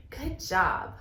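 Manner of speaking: ordinary talk, nothing marked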